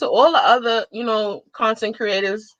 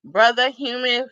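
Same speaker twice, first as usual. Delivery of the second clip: ordinary delivery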